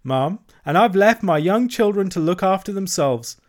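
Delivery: high, chattering washerwoman voice; Toad as washerwoman. A narrator's reading. Toad playing a washerwoman to the barge woman, high and fussy. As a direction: pile on the hard-luck tale, pitched high.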